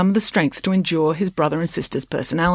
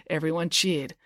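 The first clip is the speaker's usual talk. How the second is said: ordinary delivery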